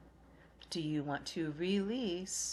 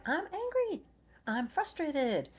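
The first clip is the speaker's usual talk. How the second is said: much higher, mock complaining inner voice; 11 semitones higher than usual. Coaching viewers, she voices their angry inner thought, pitched up high. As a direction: squeak it out like the voice in your head. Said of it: "you hear that in your head".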